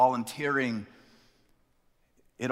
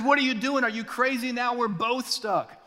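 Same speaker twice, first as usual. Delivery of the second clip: higher, incredulous outburst; mock alarm at his friend; man in the hole aghast at his friend jumping in; exasperated Harry; 10 semitones higher than usual. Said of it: "Harry says".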